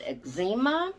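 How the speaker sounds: "eczema" stressed in the middle, eg-ZEE-muh; old-time pronunciation. Shown as a demonstration. Vlogger recalling how doctors said it back then, old-style eg-ZEE-muh for "eczema". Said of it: "is the way it was pronounced".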